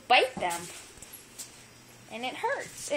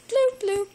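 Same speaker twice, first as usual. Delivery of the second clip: playful bloop; 8 semitones higher, 6 dB louder than usual. Pretend. Playful sound effects.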